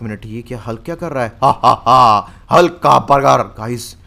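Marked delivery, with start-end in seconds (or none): 1.4-3.4 loud laugh. Acted. Hulk laughs over burger.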